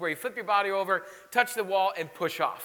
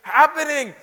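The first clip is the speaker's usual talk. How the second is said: louder, higher "what is happening", half-laughing; stunned by a baby kicking inside; baffled exclaim; 6 semitones higher, 7 dB louder than usual. Retold or imitated. Pastor imitating a parent stunned by a baby's kick, loud laughing bafflement. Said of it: "and you're like".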